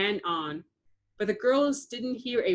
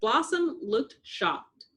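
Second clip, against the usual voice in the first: plain reading voice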